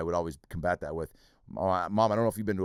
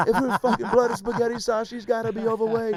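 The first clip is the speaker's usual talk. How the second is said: put-on much higher reasoning kid voice; mock kid; 13 semitones higher, 4 dB louder than usual. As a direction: play a smug little kid explaining the joke. Kid voice in playground story.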